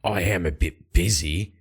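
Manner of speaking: low, curt Light voice; Light's voice. Acted. Light turns down the women's offer, low and curt. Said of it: "Light says".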